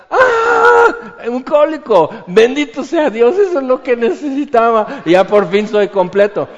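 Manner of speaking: high, loud, ironic rapture; exaggerated delight at getting colic; mock ecstatic joy. Preacher mocking fake joy in pain, high and loud.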